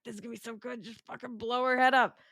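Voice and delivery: giddy, eager voice; gleeful anticipation. Retold or imitated. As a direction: relish every word. Eager anticipation quote, far voice.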